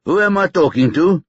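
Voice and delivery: lightly voiced policeman repeating his question; insistent police voice. A narrator's reading. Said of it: "said the voice".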